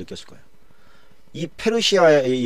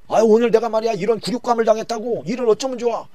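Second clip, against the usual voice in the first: humiliated whining; 11 semitones higher, 3 dB louder than usual. Acted. Haman complains to his wife.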